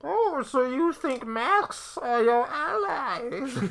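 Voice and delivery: high, very slow masked-villain parody; hammed-up supervillain taunt; Bane. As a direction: stretch it out as a silly masked villain. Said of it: "everybody can do Bane".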